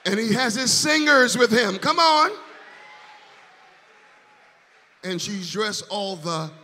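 loud, rising hype calling out the singer's name; hype host. Real, not acted. Host to church crowd, loud rising hype. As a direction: whip up the room, voice climbing to a shout.

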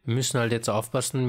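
ordinary talk

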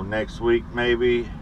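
usual speaking voice

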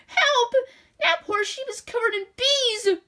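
put-on high, urgent little girl's cry; child shouting for help. A narrator's reading. Young girl calling the farmer to save the sheep, high and urgent. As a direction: call out like a six-year-old in a panic. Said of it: "Six-year-old Molly saw poor Violet and shouted".

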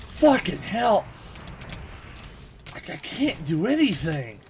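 loud, high raging yell; furious outburst. Real, not acted. Gamer killed in spawn, furious loud yell. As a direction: rage at being spawn-killed.

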